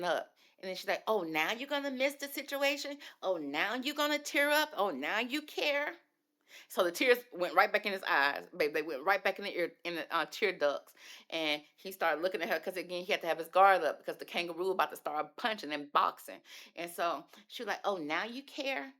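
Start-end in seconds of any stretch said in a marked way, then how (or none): 1.1-5.9 high, scornful mock disbelief; sarcastic mockery. Courtney mocking Nick's tears. 17.8-18.9 sarcastic taunt. Retold or imitated. Courtney repeating her taunt.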